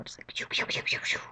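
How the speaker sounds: soft unvoiced pew pew; whispered gunshots. Imagining the OK Corral, whispered gunfire. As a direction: play cowboy shooting quietly. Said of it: "I could see, like, the OK Corral".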